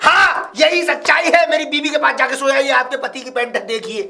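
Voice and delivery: loud, high accusation, easing toward the end; indignant outburst. Acted. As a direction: burst out at the neighbour's wife.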